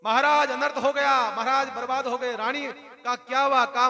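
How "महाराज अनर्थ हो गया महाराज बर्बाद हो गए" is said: loud, higher-pitched panicked wail; a servant bursting in, wailing. Acted. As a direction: cry out the bad news to the king. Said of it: "भागता भागता रोता रोता गया".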